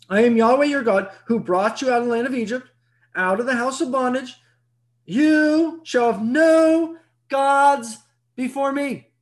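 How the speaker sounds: solemn proclamation. Acted. Proclaiming the first commandment.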